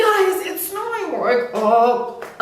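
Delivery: high, fast, repeated calls to wake everyone; bursting with joy to get them up; excited wake-up call. Retold or imitated. Calling the sleepy housemates.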